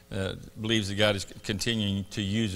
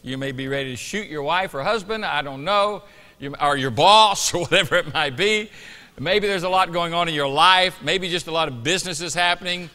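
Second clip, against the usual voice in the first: rising, louder pulpit exhortation; preaching; 6 semitones higher, 7 dB louder than usual. Acted. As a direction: build it up like a preacher. Preaches before communion.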